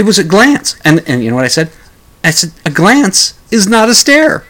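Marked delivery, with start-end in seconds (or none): 2.6-4.4 indignant retort. Retold or imitated. Host's retort to accuser.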